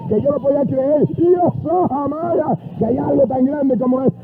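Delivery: high, mounting revival delivery; impassioned preaching. Retold or imitated. New convert longs to tell every house about Christ, fervent. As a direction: build the retold testimony phrase by phrase, pitch climbing.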